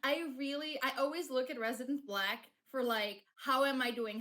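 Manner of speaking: ordinary talk, nothing marked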